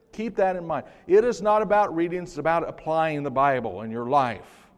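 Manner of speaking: preaching at full force. Acted. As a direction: preach it home to the congregation. Preaching climax on applying Bible.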